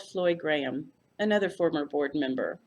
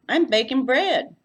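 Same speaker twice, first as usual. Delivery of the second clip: loud, high, gushing South Texas welcome; Texas hostess; 7 semitones higher, 8 dB louder than usual. A narrator's reading. Floy greeting her uniformed guest, gushing Texas hostess. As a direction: fuss over the guest like a Texas aunt. Floy's voice.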